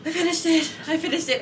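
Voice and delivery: high, excited celebration; excited announcement. Real, not acted. Celebrates finishing the cardigan.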